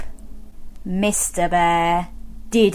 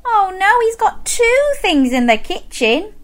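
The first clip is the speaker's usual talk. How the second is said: ordinary delivery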